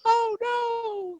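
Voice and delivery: very high, squeaky cartoon wail; Mister Bill. Retelling a TV sketch before he's thrown out the window, falsetto impression. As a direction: cry out in a tiny falsetto panic. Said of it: "And Mister Bill would go".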